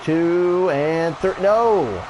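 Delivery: high, slow count then loud cry; slow suspense count. Real, not acted. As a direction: drag the count, then yell. Near-fall after Burning Hammer.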